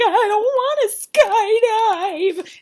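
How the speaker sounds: loud, high, drawn-out protest; mock wailing. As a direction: howl it like a dramatic contestant. Imitates contestant wailing about skydiving.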